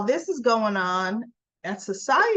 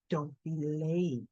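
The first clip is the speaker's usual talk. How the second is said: soft, slow, leaning-in warning; a quiet, knowing send-off to a coworker; intimate murmur; 6 semitones lower, 8 dB quieter, slower than usual. Retold or imitated. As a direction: murmur it close to his ear. Audra leans in to Kyle.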